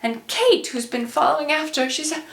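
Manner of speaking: usual speaking voice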